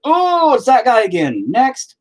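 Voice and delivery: high, loud mock groan of recognition, drawn out; exasperated FBI watchers. FBI agents spotting the streamer on camera yet again, mock exasperated. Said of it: "they're probably like".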